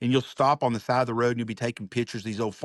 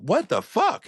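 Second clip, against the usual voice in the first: sudden high-pitched what the fuck; shocked disbelief; 11 semitones higher, 4 dB louder, slower than usual. Retold or imitated. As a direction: blurt it out in stunned disbelief. Thinking after waitress warning.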